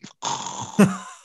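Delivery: exaggerated fake snore; mock snoring. Husband putting on a comedic show, mock snoring. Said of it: "giving a loud snoring noise".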